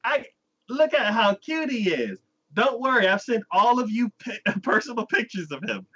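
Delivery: high, gushing proud grandpa; doting grandpa. Retold or imitated. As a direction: brag about the grandson, beaming. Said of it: "and he's like".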